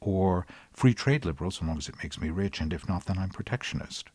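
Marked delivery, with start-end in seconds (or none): none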